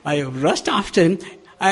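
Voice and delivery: ordinary delivery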